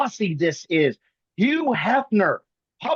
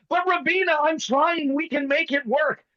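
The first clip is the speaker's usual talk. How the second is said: mock-nervous plea, pitched higher; a hammed-up pleading suitor, higher; nervous Tommy; 7 semitones higher than usual. Host mimics nervous Tommy pleading with Rabina, pitched higher. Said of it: "Tommy starts nervously stroking his beard".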